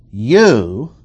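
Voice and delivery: "You" hit hard and drawn out, set apart; stress on You. Shown as a demonstration. Teacher reading the verse to show emphatic pronouns, stressing "You". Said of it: "I will stress the four emphatic pronouns".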